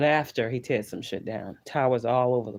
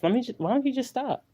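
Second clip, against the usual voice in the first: much higher and quick, exasperated "why don't you just stop"; annoyed high priestess; 11 semitones higher, faster than usual. Retold or imitated. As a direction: play the fed-up priestess snapping back, fast and high. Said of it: "That's the high priestess".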